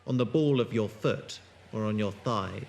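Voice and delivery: low, measured narration, eerie and unhurried; story narration. A narrator's reading. Narrator setting an ominous scene, in low measured story voice. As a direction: draw listeners into a creeping mystery. Narrator opens the audio drama.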